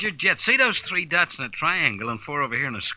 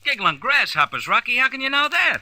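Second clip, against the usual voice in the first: astonished; 9 semitones higher than usual. Acted. Astonished exclamation.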